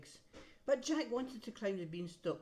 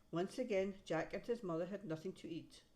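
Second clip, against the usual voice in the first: plain reading voice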